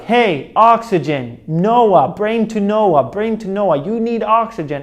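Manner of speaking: urgent alarm call. Retold or imitated. Brain alarm calling out.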